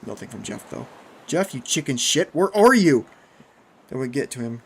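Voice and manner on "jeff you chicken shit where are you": loud, rising, provoking shout; calling out. A narrator's reading. Narrator goading silent Jeff to answer, shouting. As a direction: yell a taunt into the dark.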